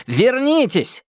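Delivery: high shout to come back; calling out. Acted. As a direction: call them back sharply. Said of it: "окликнул их Минхо". (окрик)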